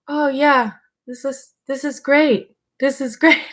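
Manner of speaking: high, forced cheer; fake enthusiasm. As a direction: pretend it's great, unconvinced. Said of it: "And me being like".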